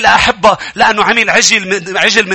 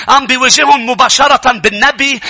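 usual speaking voice

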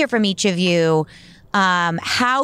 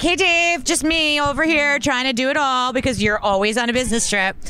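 sarcastic complaint; 4 semitones higher than usual. Retold or imitated. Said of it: "I'm like".

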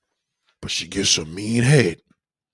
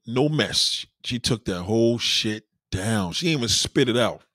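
usual speaking voice

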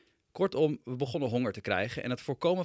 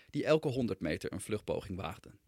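ordinary delivery

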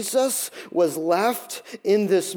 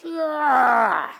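mock gasp; 6 semitones higher than usual. Stab in the back.

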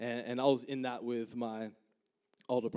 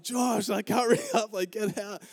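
high-pitched retold yell; urgent calling out; 7 semitones higher, 3 dB louder than usual. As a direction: imitate hurrying a brother out. Retold yelling at brother to escape.

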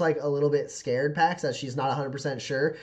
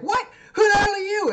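very high, loud shriek; hammed-up hysterical demand; screaming woman; 8 dB louder than usual. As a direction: screech it at an intruder. Screaming woman confronts rescuer.

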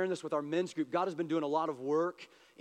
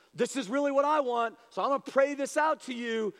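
high, pressing prayer; insistent pleading; 6 semitones higher than usual. Retold or imitated. Our humanity side praying to God, pleading insistently. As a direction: push the want upward, raised and earnest. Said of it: "humanity side rising up in us".

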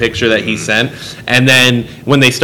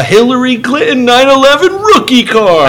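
mock hype voice; 13 semitones higher, 3 dB louder than usual. Imitating card-opening TikTok guy.

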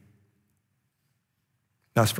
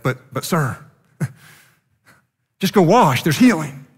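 servants begging their master, higher and louder with a laugh; pleading servant; 4 semitones higher, 4 dB louder than usual. Retold or imitated. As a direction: coax the master to just go wash, laughing and higher. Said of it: "his servants pleaded with him".

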